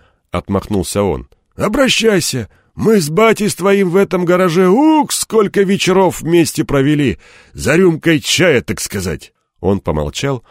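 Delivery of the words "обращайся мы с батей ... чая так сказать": gruff mechanic voice. Acted.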